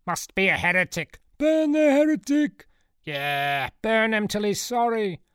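high, loud crowd heckling; angry mob shouts. A narrator's reading. Indignant churchgoers condemning a heretic, shouting angrily. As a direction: cry out like an outraged mob.

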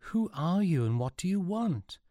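high-pitched challenge, swooping up; wary hail at a distance; calling across. A narrator's reading. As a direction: call out to strangers across a gap. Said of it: "called Sean".

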